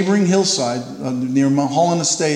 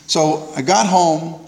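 ordinary delivery

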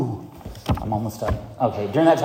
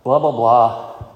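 bored spiel; 5 dB louder, much slower than usual. Retold or imitated. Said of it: "I do the usual spiel".

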